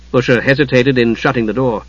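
ordinary delivery

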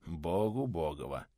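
slow, soft, weighty summing up; meaningful. A narrator's reading. Милован sums up meaningfully, slow and soft. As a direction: let it sink in slowly. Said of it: "многозначительно подытожил Милован".

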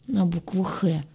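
lightly rasped, weakened voice; hoarse croak. A narrator's reading. Weak patient answers worried captain, hoarse croak. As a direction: croak it out with a sore throat. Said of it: "прохрипела".

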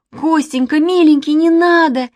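high, loud begging; wailing plea at breaking point; desperate pleading. A narrator's reading. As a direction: beg him to stop, scared. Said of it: "не выдержала и Катя".

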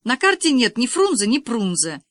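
high, loud exasperation; blurted complaint. A narrator's reading. Girl fearing a bad mark blurts out, exasperated. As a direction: complain in an agitated outburst. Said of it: "выпалила Лиза".

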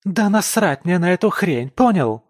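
high, loud raging shout; wife screaming at her husband in rage; furious yell. Acted. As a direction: scream it at him in fury. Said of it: "Её лицо перекашивает от ярости".

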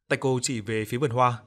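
usual speaking voice

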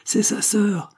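high-pitched cry without much extra volume; crowd's exclamation. A narrator's reading. Crowd backing the supposed brother with one voice, exclaiming. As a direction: chime in like an excited onlooker. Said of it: "exclama tout d'une voix la foule".

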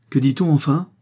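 high, loud exasperated demand; losing all restraint. A narrator's reading. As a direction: burst out, demanding an answer. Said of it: "perdant toute mesure". (exclamation exaspérée, haute et forte)

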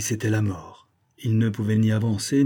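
usual speaking voice

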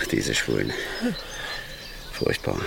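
low, soft grumble to himself; grumbling. Acted. Alone after the young man leaves, grumbling to himself. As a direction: mutter a weary complaint.

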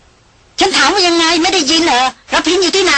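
high, slow furious demand; the noblewoman snaps at the evasive caller; loud snap. A narrator's reading. Noblewoman shouting a demand down the phone, slowly and high. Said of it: "ตวาดลั่น".

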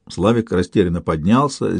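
ordinary delivery

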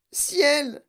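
very high exclamation of horror; panicked cry. A narrator's reading. Mother horrified by swelling daughter, panicked cry. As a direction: wail the single word high. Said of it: "hurla madame Beauregard".

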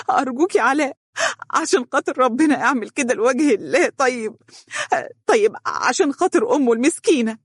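high sobbing plea with gasps; crying, begging. A narrator's reading. Wife begs husband to show mercy, crying. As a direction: beg through heaving tears. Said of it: "وهي تبكي وتقول".

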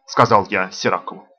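ordinary delivery

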